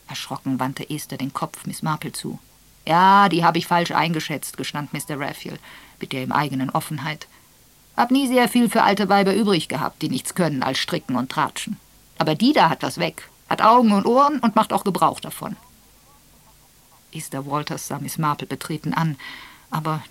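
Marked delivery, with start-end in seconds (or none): 2.9-4.6 Mister Raphael, louder than the narration and frank; a blunt old man owning up to his mistake. Acted. Old Raphael admits to Esther that he misjudged Miss Marple, a little louder. 8.0-15.6 Mister Raphael, blunt, louder and higher; an old grump who grudgingly praises her; blunt old man. Acted. Raphael tells Esther he respects Miss Marple, blunt and raised.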